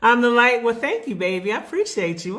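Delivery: normal talking voice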